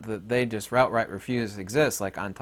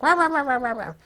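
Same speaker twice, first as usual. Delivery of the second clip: mock yelling noise; 13 semitones higher than usual. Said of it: "That's what people think".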